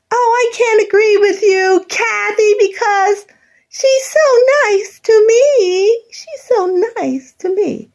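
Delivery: high, slow, simpering imitation of Peg; mock Peg. Mimicking oldest sister Peg defending the widow, simpering mockery. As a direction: whine it out sweetly, mocking. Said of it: "Peg said to you".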